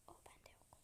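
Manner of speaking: "O bende yok" fully whispered, no voice; whispering. Real, not acted. Whispered repeat of line 16.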